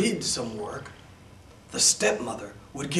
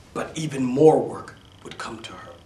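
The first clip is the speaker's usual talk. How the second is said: ordinary delivery, nothing marked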